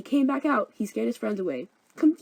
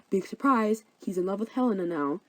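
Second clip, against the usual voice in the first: usual speaking voice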